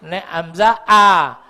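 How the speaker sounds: slow glottal a, clipped short at the throat; hamzah sound a. Shown as a demonstration. Teacher demonstrating hamzah a to students. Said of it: "Sing penting beda a karo ain".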